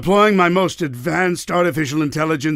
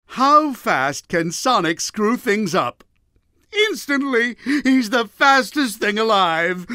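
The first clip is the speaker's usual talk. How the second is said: higher, relishing delivery of a joke; amused joke-telling; 7 semitones higher than usual. Retold or imitated. Villain retells his AI's joke about Sonic, amused. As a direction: set up and land the punchline gleefully.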